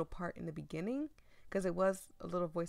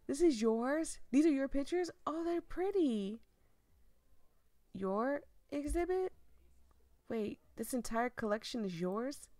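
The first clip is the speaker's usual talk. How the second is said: higher, delighted disbelief; amazed at the pictures; surprised delight; 6 semitones higher, 4 dB louder than usual. Acted. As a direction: let the surprise lift the voice. Heroine discovers it's his exhibit.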